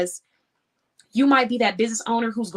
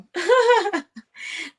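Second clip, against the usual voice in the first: high, loud burst of real laughter; sudden high giggle burst; 12 semitones higher, 5 dB louder than usual. Real, not acted. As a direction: break out laughing, pitched way up. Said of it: "why I'm laughing".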